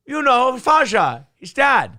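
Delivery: loud, high mock Dutch accent; Goldmember Dutch accent. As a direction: keep the hammed-up Dutch Goldmember voice. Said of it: "Austin Powers Faja".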